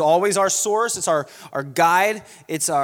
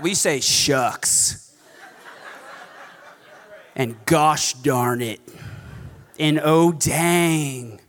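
slow, drawn-out mild oaths; mock-prim swearing; 2 semitones lower, much slower than usual. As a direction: savor each tame swear word.

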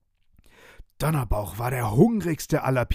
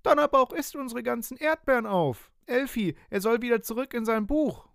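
put-on much higher, tearful child voice; whiny upset child; 12 semitones higher than usual. Boy upset as pirate eats strawberries, whining high. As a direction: whine like a little boy close to tears. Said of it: "Franz fängt an zu weinen".

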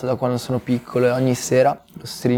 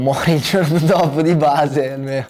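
higher-pitched, breaking into a laugh; amused, laughing; 4 semitones higher than usual. Real, not acted. Speaker joking to interviewer about an old fear, amused and laughing. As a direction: laugh through the words.

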